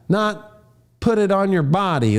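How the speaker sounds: usual speaking voice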